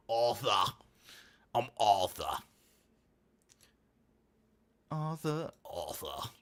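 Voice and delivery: lightly put-on Brummie; trying out a Brummie accent on the name; attempted Brummie accent. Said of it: "the Brumman accent needs work".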